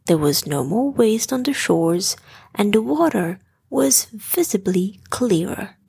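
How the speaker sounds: usual speaking voice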